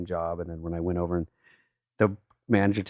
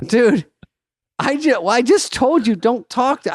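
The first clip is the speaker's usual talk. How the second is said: higher, louder scolding; exasperated manager; 13 semitones higher, 5 dB louder than usual. Retold or imitated. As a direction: scold him for talking to tables. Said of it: "And he goes".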